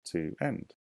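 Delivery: Five again drawn out, one syllable at a time; slow syllable count. Shown as a demonstration. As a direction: split the words to count them. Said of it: "I think again is two words".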